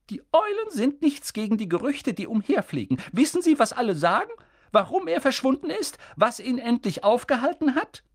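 stern witch pushing for answers; urgent McGonagall. A narrator's reading. McGonagall voiced in the reading.

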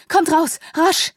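high, loud urgent shout; urgent call. A narrator's reading. Sidonia urging Shuna and the children out of the cellar, urgent call.

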